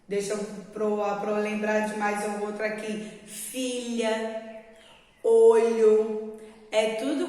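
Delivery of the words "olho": ordinary talk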